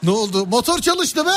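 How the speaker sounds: high, delighted cry; excited car owner. Retold or imitated. Mock owner thrilled his engine started. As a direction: sound thrilled the engine came alive.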